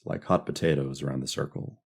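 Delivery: plain reading voice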